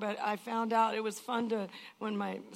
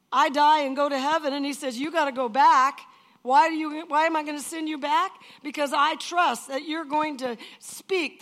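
louder, driving preacher's delivery; full-force preaching; 2 semitones higher, 7 dB louder than usual. Acted. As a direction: preach it out to the crowd.

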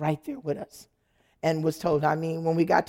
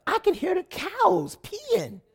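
dismayed wail; 14 semitones higher, 3 dB louder than usual. Retold or imitated. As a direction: wail it in high disbelief. Horrified wife in the barn.

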